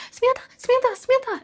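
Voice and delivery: high, loud frantic yell of a name; mom screaming name. Retold or imitated. Mother screaming for her daughter in the night, frantic high yell. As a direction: scream the name like a panicked mother waking you. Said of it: "I hear my mom screaming".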